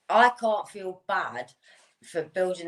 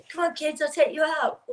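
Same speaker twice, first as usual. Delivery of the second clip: mock cheerful call; 12 semitones higher, 3 dB louder than usual. Mock developer calling kids to climb.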